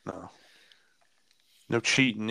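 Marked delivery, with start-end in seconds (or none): none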